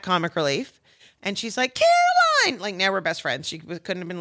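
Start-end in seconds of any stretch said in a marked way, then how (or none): 1.7-2.6 very high, sung-out name call; effusive greeting. Retold or imitated. Film star greeting her at benefit, effusive squeal. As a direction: squeal the name like a best friend.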